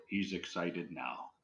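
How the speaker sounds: low, soft, slow imitated voice; different ghostly voice. Imitating another voice heard on a recording, low and slow. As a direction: drop down quiet and draw it out. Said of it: "you hear a different voice go".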